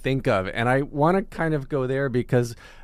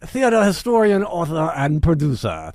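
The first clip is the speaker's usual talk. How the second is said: high, loud grand ringside announcer; old-timey announcer; 8 semitones higher, 5 dB louder than usual. Pretend. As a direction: boom it out like an old Madison Square Garden announcer. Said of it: "I felt like that was an old-timey voice".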